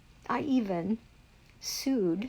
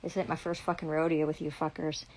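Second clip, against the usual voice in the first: ordinary talk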